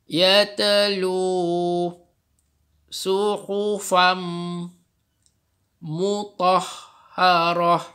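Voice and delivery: slow, drawn-out chanted recitation; Quran recitation. Shown as a demonstration. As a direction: recite it slowly in tajwid style. Recites verse for class.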